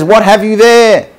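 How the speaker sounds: high, loud palace guard; challenging guard. Acted. Guard at the palace to the fisherman, loud challenge. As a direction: call out the question loudly and high.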